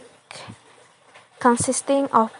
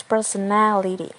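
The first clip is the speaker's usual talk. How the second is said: "personality" with main stress on the NA syllable; "personality" peaking on its third syllable, then falling; stress shifted in personality. Shown as a demonstration. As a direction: lean on NA: per-so-NA-li-ty. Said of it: "stress move from per to ne".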